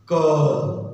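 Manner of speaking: क said loud from the back of the throat; throat sound demo. Shown as a demonstration. Teacher demonstrating क for students. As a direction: push क out from the throat. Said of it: "छोटा सा मैं इसका demo दूं".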